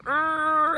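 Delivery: loud high growl of frustration; frustrated groan. Retold or imitated. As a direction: let out a fed-up urgh. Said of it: "I just wanted to scream and holler".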